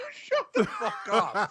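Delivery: laughing while swearing. Real, not acted. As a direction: laugh through the words. Laughing protest.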